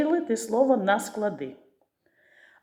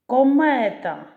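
"Комета" high and loud, middle syllable stressed; наголос на другому складі; 5 semitones higher, 5 dB louder, slower than usual. Shown as a demonstration. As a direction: punch the second syllable of "Комета". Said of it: "на другий". («Комета» голосно, з наголосом на другому складі)

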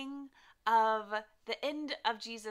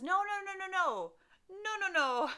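scared denial; 9 semitones higher, 4 dB louder than usual. Acted. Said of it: "because he was scared".

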